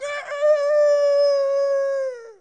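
loud, high burst of laughter. Real, not acted. Preacher laughs out loud at his own joke.

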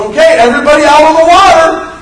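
high, booming announcement to a crowd; comically loud call to all; mock shouted order. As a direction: holler it like rounding everyone up. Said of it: "Jesus never said".

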